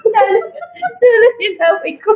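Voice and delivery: high wailing fake sob; mock crying. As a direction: whine it through fake tears.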